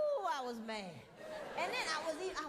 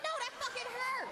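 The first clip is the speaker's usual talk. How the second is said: pained protest; 9 semitones higher than usual. Retold or imitated. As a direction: cry out that it hurts. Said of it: "I was like".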